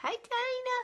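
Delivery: high sing-song hello; high greeting. Real, not acted. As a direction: sing out the name. Greeting a viewer.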